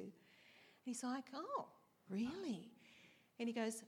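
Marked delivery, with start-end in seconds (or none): 1.3-2.7 slow, higher, mock-amazed "Oh, really?"; lightly voiced calm preacher feigning surprise. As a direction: play at being impressed, unbothered. Unbothered reply to a curse.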